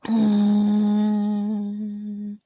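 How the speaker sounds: usual speaking voice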